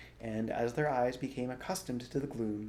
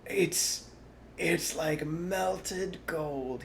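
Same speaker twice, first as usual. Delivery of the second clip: very slow, halting wonder; gasped awe; 7 semitones higher, much slower than usual. A narrator's reading. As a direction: gasp it out dreamily. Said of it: "he gasped".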